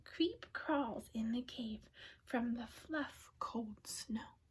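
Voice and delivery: soft storybook whisper. A narrator's reading. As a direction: whisper as if sneaking past a sleeping bear. Mouse sneaking into the cave.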